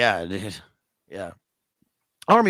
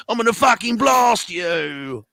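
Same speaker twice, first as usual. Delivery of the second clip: hammed-up threat shouted higher and louder; mock shout; 10 semitones higher, 4 dB louder, slower than usual. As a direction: yell the threat like a caricature. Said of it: "He would have been like".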